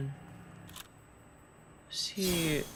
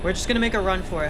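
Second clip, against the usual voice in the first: fast, loud, urgent rush; blurting it out while running; being chased; 3 semitones higher, 9 dB louder, much faster than usual. Real, not acted. Fleeing a monster in game.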